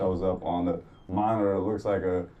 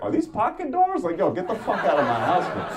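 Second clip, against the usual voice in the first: high, laughing mock tough guys; hammed-up rowdy bro voice; mock rowdy firemen; 9 semitones higher, 3 dB louder than usual. Firemen barging in, mocked with a laugh. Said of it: "The other five are like".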